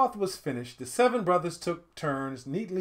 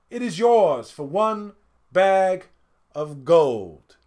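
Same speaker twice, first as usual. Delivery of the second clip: slow and louder, weighing each word; firm price; 2 semitones higher, 7 dB louder, slower than usual. A narrator's reading. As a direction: lay down the price firmly. Oldest names the price.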